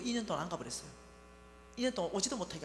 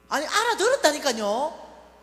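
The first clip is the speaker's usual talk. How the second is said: loud, higher and slow, pressing the point; loud insistence; 6 semitones higher, 12 dB louder, slower than usual. Retold or imitated. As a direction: insist loudly to a stubborn mother. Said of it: "이제 이렇게 큰 소리가 나오는 거죠".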